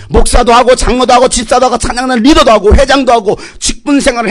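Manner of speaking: urgent sermon build-up; full-force preaching. Acted. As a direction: drive it home like an impassioned preacher.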